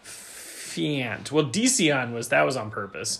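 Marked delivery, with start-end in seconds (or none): 0.0-1.3 Fiant said as fee-ant by mistake; slip of the tongue. Real, not acted. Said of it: "I don't know what happened there".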